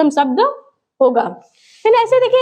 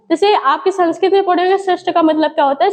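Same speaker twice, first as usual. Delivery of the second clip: ordinary talk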